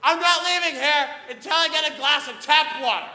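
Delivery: high, loud comic scream; over-the-top shrill tantrum. Retold or imitated. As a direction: rage at the cupcake clerk. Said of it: "I'm screaming at someone".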